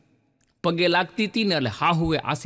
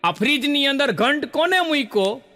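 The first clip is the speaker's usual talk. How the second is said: raised, higher mock yell of a startled mother-in-law; mother-in-law's shout; 7 semitones higher than usual. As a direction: shout it out like a shocked mother-in-law finding a bell in the fridge. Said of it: "સીધી રાડ પાડી". (સાસુની ઊંચા અવાજે નકલી રાડ)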